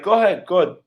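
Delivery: put-on dismissive retort with a slight Russian accent; mock Russian accent. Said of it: "with her fucking Russian accent".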